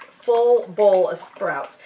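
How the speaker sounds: each word said slowly and carefully; slow tongue twister. Shown as a demonstration. To viewers, trying the tongue twister very slowly. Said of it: "Try saying that ten times fast".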